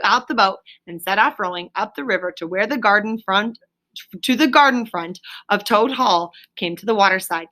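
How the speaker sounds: ordinary delivery, nothing marked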